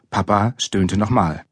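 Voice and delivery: plain reading voice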